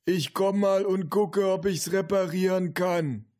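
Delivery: slow, high-pitched grandpa call. A narrator's reading. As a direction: call from another room, every word drawn out. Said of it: "rief der Opa". (rufender Opa, hoch und gedehnt)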